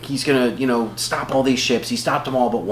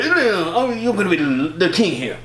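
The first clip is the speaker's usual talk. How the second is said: badly faked comic impression, pitched up; Bernie Mac; 7 semitones higher than usual. Playing him as the would-be elder, bad grumbling impression. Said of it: "Your Bernie Mac is horrible".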